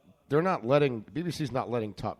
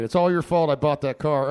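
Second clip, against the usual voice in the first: louder playful accusation; repeating the playful blame; mock blame again; 3 semitones higher, 8 dB louder than usual. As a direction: call it out again across the room. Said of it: "He doesn't hear me".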